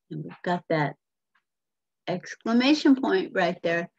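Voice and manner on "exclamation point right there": ordinary delivery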